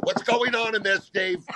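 wife pounding on the door, calling out high and loud; mock shout through a door. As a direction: yell it at the dressing room door. Edie banging on the door.

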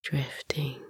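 fading whisper. Acted. Repeated words fading into sleep.

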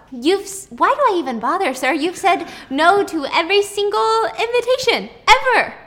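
high, rising exasperated complaint; exasperated secretary. Retold or imitated. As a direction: throw up your hands.

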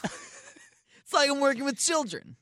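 put-on high slow cute anime girl. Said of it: "that's my anime voice".

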